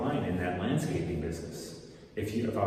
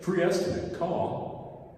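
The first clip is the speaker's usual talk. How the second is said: mock sign slogan; 7 semitones higher, slower than usual.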